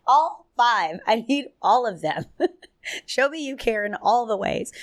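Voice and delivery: high, loud and giggly; laughing excitement. Real, not acted. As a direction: gush about wanting it all. Laughing about her love languages.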